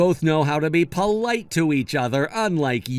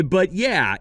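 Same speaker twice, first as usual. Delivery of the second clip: usual speaking voice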